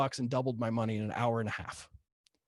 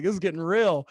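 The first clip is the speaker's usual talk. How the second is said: retold fear; 5 semitones higher, 7 dB louder than usual. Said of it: "the fear starts kicking in".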